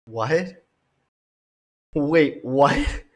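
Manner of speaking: a stunned, rising what; can't believe what you just heard; stunned disbelief. Real, not acted. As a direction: double take, wait what. Stunned by the guest's claim.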